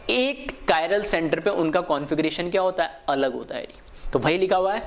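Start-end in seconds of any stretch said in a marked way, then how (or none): none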